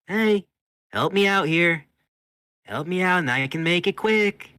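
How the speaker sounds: high, loud, insistent appeal; loud coaxing call. Acted. Myrna calling to the screaming rat, loud and high. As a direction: call over someone's screaming.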